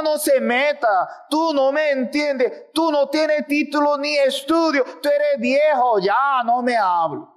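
put-on high, dismissive young voice rejecting an old parent; scornful child. Preacher voicing a child scorning parent, high dismissive voice. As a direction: brush off the old man, pitched high.

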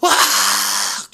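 a short mock scream of shock; retold scream. The sister finds the open window, a short scream. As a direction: cry out as the girl, without full force. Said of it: "gritó".

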